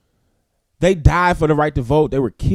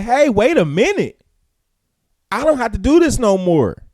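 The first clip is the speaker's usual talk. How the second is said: higher-pitched voicing of a group pushing back; mock protest, pitched up high; indignant objection; 7 semitones higher than usual. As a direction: speak up for people who have had enough. Voices group objecting, in a quote.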